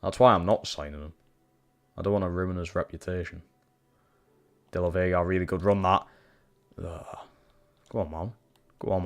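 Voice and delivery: ordinary delivery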